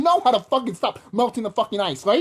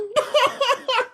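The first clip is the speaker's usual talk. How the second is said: a sharp, high scornful laugh; 3 dB louder than usual. Real, not acted. Laughing high in scorn at a listener's idea.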